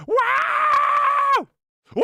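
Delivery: very high, loud droid wail; long high astromech shriek imitated by mouth; R2-D2 scream. Streamer giving chat a requested impression, screaming R2-D2. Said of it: "I'll give you an R two".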